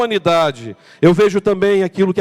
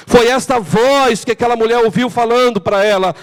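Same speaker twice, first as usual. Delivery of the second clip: normal talking voice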